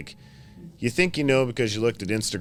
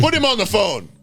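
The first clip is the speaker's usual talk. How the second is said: much higher and louder insistence; hammed-up order to hand over the phone; loud high demand; 12 semitones higher, 7 dB louder than usual. Shop owner demanding to speak to the club president, loudly. Mock confrontation continues.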